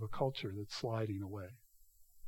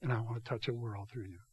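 hushed voice of God; 4 semitones lower, 10 dB quieter than usual. Acted. Preacher voicing God softly.

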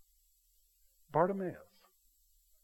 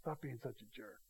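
very soft, low shushing scolding; hushing; 5 semitones lower, 16 dB quieter than usual. Acted. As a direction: hush a nuisance under your breath. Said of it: "telling them to be silent".

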